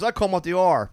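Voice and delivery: retold punchline. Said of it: "He goes".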